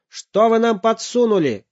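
loud, high furious shout. Acted. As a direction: storm at the clerk. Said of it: "закричал папа".

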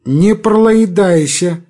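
пролоедайся with l for r, slowly; burry пролоедайся. A narrator's reading. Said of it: "своим картавым голосом".

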